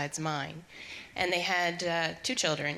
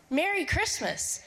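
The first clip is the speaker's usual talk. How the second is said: high, slow call-back; imitated crowd greeting; 9 semitones higher than usual. Crowd answering the boy's wave, imitated high call. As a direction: voice onlookers returning the greeting, pitched up. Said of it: "And they're like".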